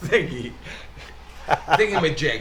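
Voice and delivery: giggly, amused; laughing. Real, not acted. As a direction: laugh out the silly word. Laughing at the show name.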